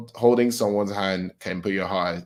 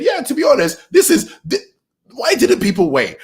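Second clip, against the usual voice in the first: loud, very high-pitched exasperation; worked-up rant; 8 dB louder than usual. Real, not acted. Fan defending the ending to impatient readers, worked-up exasperation. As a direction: protest loudly, voice shooting way up.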